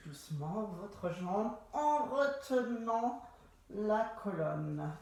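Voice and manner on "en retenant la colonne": stretched syllables, held back; drawn-out coaching. Shown as a demonstration. Instructor guiding a slow roll-down, drawn-out words. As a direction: draw the words out as if resisting.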